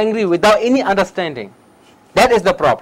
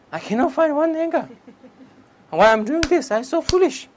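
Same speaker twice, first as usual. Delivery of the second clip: much higher, mock-baffled self-talk; puzzled seeker; 9 semitones higher than usual. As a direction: play a confused student laughing at himself. Meditator acting out failed search.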